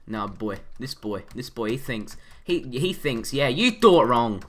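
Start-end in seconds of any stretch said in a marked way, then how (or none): 3.3-4.4 loud, slow gloating jeer; taunting. Real, not acted. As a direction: rub the win in his face. Taunting an enemy player.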